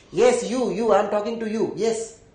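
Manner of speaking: usual speaking voice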